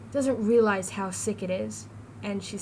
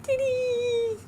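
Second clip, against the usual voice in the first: mock yell. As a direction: belt it out to tease mom. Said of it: "I yell".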